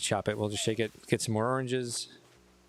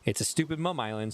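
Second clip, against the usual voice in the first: ordinary talk, nothing marked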